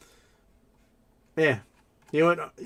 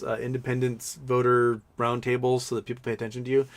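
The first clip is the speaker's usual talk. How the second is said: ordinary talk, nothing marked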